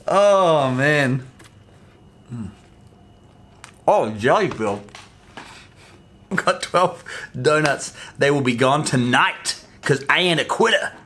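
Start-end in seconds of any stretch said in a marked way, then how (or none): none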